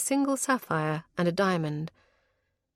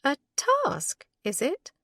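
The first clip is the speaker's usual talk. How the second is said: mock horrified; 8 semitones higher, slower than usual. A narrator's reading. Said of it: "horrified amusement".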